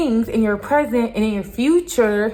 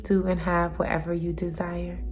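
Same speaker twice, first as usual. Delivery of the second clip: soft whisper; 3 semitones lower, 7 dB quieter than usual. Real, not acted. Sign-off affirmation.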